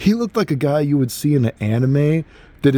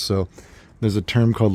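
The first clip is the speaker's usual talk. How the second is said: ordinary talk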